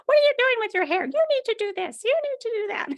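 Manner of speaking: put-on high squeaky three-year-old voice; squeaky kid voice. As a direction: squeak it out like a bossy toddler. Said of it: "squeaky little kid voice".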